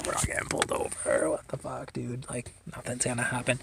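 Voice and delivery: very soft, voiceless mock reassurance; hushed dismissal. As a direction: let the acted protest sink to a whisper. Passenger dismisses buddy's panic.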